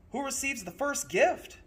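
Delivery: put-on chorus of eager children. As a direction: ask like excited kids. Children ask together.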